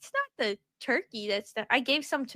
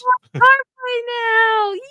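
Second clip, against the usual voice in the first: slow, high, loud cheer; excited cheer; 12 semitones higher, 10 dB louder, much slower than usual. Real, not acted. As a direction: cheer it out drawn out. Mock cheering self.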